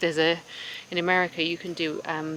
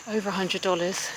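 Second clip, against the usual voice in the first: ordinary talk, nothing marked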